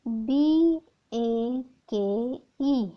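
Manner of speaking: letters spelled very slowly; slow spelling. Shown as a demonstration. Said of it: "जैसे मैं यहाँ पे लेती हूँ".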